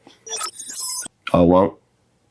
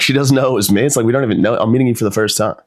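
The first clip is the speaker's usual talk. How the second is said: ordinary delivery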